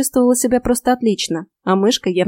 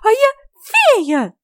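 very high, slow fairy squeak; indignant little fairy; offended fairy; 4 dB louder, much slower than usual. A narrator's reading. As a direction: declare it proudly in a tiny high voice. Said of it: "голос при этом благоразумно понизила".

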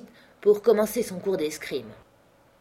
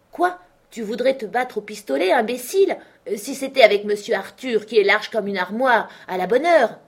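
louder mocking rebuke; mild scolding; 5 semitones higher, 6 dB louder than usual. A narrator's reading. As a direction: call him an idiot, half-joking. Manxit scolds. (Manxit qui gronde, à moitié moqueur)

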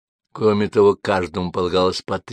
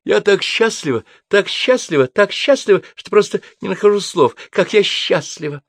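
much higher, gushing overjoyed voice; gushing happiness; 12 semitones higher, 4 dB louder than usual. Acted. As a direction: overflow with happiness. Rimma gushing congratulations.

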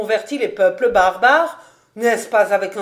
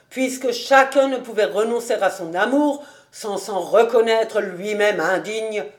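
plain reading voice

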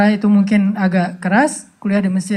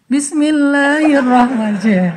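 slow, higher, drawn-out Quranic recitation; showy recitation; 5 semitones higher, slower than usual. Acted. Suitor showing off his recitation to her, slightly showy. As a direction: recite to impress, slowly and ornately. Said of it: "Ria-ria dikit lah".

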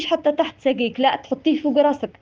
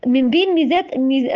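ordinary talk, nothing marked

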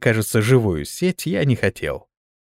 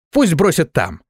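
higher-pitched insistent order; mild roar; 5 semitones higher than usual. A narrator's reading. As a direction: raise the voice a little in protest. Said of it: "заревел я". (настойчивый окрик, повыше)

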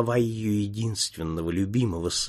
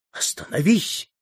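mild shout; 6 semitones higher than usual. A narrator's reading. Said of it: "кричала графиня".

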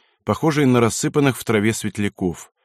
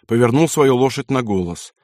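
ordinary delivery

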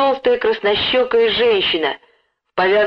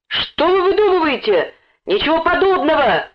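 high, protesting cry; indignant shout; 9 semitones higher than usual. A narrator's reading. Boy denies knowing the thief, high indignant cry. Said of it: "кричал Лёнька".

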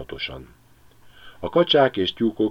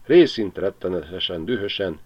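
usual speaking voice

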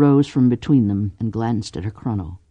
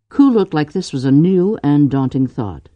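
usual speaking voice

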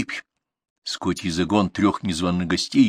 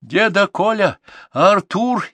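high, urgent distant hail; shouting from afar; 12 semitones higher, 4 dB louder, slower than usual. A narrator's reading. Girl races up on a bicycle, shouting to them. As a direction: cry out their names from far off. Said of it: "издали закричала она".

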